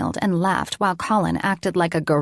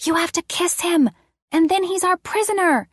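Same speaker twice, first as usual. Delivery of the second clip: put-on high-pitched child voice; a kid explaining the game rules; little girl's secret instruction; 11 semitones higher than usual. A narrator's reading. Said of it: "whisper in my ear".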